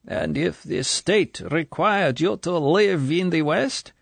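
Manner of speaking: slow, high, testing question; probing colonel. A narrator's reading. Colonel testing the girl's loyalty, slow and probing. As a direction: press the point.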